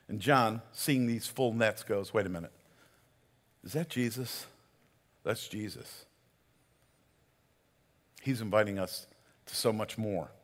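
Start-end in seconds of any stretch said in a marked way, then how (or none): 2.1-6.0 hushed realization. Retold or imitated. John recognizing Jesus in wonder.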